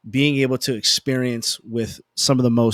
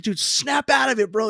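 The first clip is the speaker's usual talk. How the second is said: much higher pitch, urging a pal to snap to; mock-urgent pep talk pitched way up; urgent friend, imitated; 10 semitones higher than usual. Friend backstage to a nervous DJ, imitated urgent high-pitched urging.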